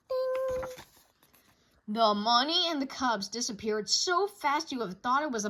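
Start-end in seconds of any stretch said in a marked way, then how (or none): 0.1-0.7 high sung ding chime; high bell-like ding. Acted. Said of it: "page-turn signal".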